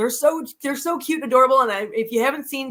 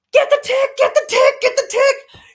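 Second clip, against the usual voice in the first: shrill-pitched frantic yelling, much higher and louder; panicked high yell; 7 dB louder than usual. Retold or imitated. To her brothers about the tick, frantic high yelling. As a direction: yell it like a bug is on you.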